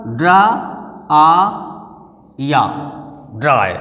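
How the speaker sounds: ड्राई broken into ड्रा, आ, या, then joined; spelled-out pronunciation. Shown as a demonstration. Teacher showing how it is written, sounded out in parts. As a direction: sound out ड्राई piece by piece with pauses. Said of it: "इसको लिखेंगे इस तरह से".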